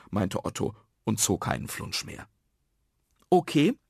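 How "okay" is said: put-on high-pitched, keen child; suggesting boy. Karl proposes building houses, keen. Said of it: "schlug Karl vor".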